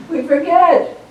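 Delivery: bright emphatic confession. Acted. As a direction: throw it out, owning the fault. Emphatic admission to congregation.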